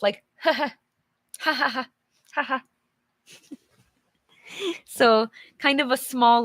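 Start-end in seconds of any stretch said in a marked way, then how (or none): 0.4-2.6 little chuckle. Shown as a demonstration. Teacher shows students what a chuckle sounds like. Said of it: "It's like a little laugh".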